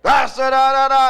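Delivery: loud, high mock-German rant; mock oration at full blast; rise high, catch fire. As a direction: shout nonsense syllables like a rally orator. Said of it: "rise high, catch on fire".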